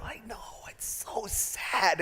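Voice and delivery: whispered, slow, choked-up mock sob; teary whisper. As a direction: whisper it as if moved to tears. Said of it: "I just go".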